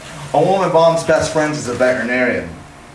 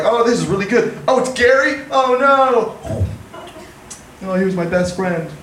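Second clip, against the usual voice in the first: sudden high-pitched gasp of dismay; mock horrified realization; 8 semitones higher than usual. Comic tells crowd about eating his friend Gary, high mock horror. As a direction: ham up the shock of tasting a friend.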